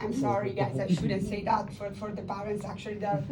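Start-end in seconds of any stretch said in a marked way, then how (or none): none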